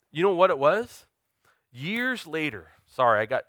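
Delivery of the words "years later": slow, catching in the throat; the words stall as tears rise; choked up. Real, not acted. Pastor telling his congregation about his past, choked up. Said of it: "I got choked up".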